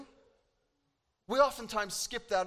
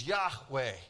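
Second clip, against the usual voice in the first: lower, solemn divine voice; God speaking from the burning bush, lower and solemn; 6 semitones lower than usual. Acted. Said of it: "a voice from God says".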